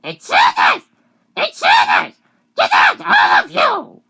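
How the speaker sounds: full-volume high screamed quote; loud, frantic imitation of a character; acted alarmed shouting. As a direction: yell it to clear everyone out. Acts out a character's outburst.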